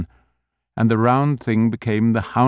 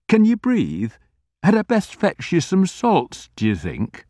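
put-on fussing old grocer; 9 semitones higher than usual. A narrator's reading.

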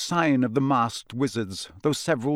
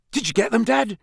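higher, louder sharp question; 10 semitones higher, 4 dB louder than usual. A narrator's reading. Son sharply questioning his father. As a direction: fire the question at him. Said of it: "said Bill sharply".

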